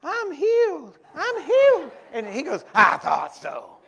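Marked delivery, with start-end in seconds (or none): 0.0-1.9 very high, loud squealed exclamation; mock healed cry. Woman released by the healing preacher, high mock cry. 2.8-3.8 slow, booming preacher impression; drawn-out loud bellow, full of certainty; big old voice. Preacher replying to the healed woman, slow big voice. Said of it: "he had this big old voice".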